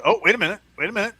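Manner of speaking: surprised. Real, not acted. Noticing wife is present.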